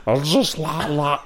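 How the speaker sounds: squeaky giggling catchphrase; botched impression through giggles; Tommy Cooper impression. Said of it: "Let me do it again".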